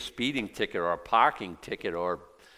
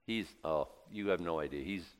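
usual speaking voice

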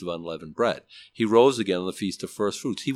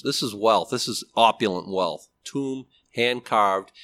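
usual speaking voice

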